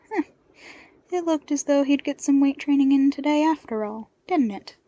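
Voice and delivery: amused at the chore ahead; deadpan and wry; dry wry. Acted.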